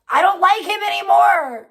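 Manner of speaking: loud, high, fed-up declaration; real anger at a fallen idol; angry outburst. Real, not acted. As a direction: blurt it out in disgust. Angry outburst at chat about David.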